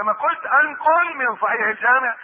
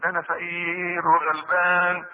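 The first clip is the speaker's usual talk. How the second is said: pleading poor man; 4 semitones lower, slower than usual. Acted. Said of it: "ويقول له".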